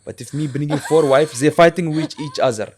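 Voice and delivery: put-on broken-English father character accent; lightly voiced old father with a heavy accent; Abu Zubair accent. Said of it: "He has an accent".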